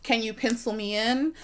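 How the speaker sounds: ordinary talk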